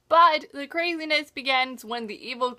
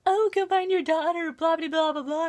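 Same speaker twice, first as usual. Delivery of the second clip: mock yelling Regina; 5 semitones higher than usual. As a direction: brush him off with a sing-song parody. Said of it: "yelling at David".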